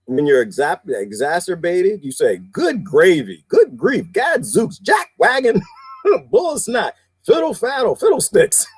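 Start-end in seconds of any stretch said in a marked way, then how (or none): 2.4-8.7 lightly mimicked exasperated uncle, pitched high; high, drawn-out old-time mock curses; talking like uncles. Host mimicking uncles' substitute cuss words, mock exasperated. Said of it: "I have uncles who talk like that".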